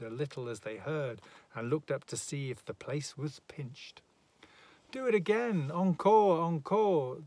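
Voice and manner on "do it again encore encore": loud, high crowd clamour; calling for an encore. A narrator's reading. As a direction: cheer and demand more, pitched high. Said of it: "the brothers submitted".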